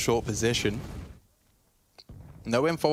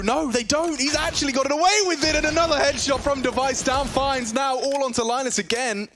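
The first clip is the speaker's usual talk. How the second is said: esports caster; 10 semitones higher than usual. Real, not acted. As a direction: build the call higher and faster. Live play-by-play building up.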